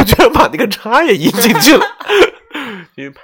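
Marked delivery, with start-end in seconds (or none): 0.0-3.0 breaking into laughter, louder and higher; laughing disbelief. Real, not acted. Host cracking up over a colleague's name mistake, laughing. Retelling a funny name blunder.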